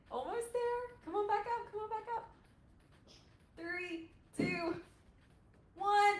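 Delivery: high-pitched cheering through a hold; high, effortful countdown; urging encouragement. Real, not acted. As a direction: coax them to hang on, voice way up.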